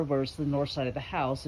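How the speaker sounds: ordinary delivery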